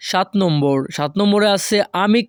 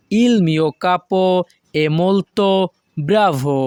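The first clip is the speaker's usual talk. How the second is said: slow, careful Italian model reading; teacher pacing it out; slow model sentence; much slower than usual. Shown as a demonstration. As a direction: say it slowly for learners to copy. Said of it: "তখন আমাদেরকে বলতে হবে".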